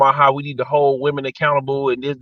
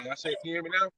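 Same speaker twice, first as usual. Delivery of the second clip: faint, cutting out phone voice; distant, breaking up call; call dropping out; 3 semitones higher, 14 dB quieter, much faster than usual. Real, not acted. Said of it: "it's still bad".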